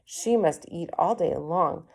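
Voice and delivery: ordinary delivery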